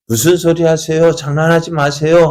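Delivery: voiced as the doubting girl at the door; disbelieving retort. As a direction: dismiss it as a silly prank. Rhoda answers Peter at the gate.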